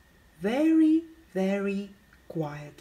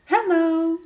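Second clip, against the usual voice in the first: high, louder squeaky hello; squeaky branch voices; 7 semitones higher, 5 dB louder than usual. Acted. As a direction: squeak it out small. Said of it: "Squeaky voices on the branches said".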